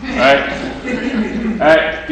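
normal talking voice

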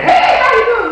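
overly bright churchy hello; fake cheery greeting. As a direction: put on the public smile and holler it. Public-face greeting, acted.

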